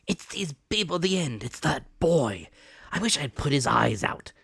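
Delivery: high, loud vicious snarl; blind man crying out. Acted. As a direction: spit out the threat. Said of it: "cried the blind man Pew".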